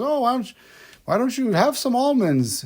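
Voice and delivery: put-on high, sweet old lady voice, pitched well up. A cleaner's story, the old woman offers almonds in a high old lady voice. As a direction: chirp it like a frail grandma. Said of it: "She goes".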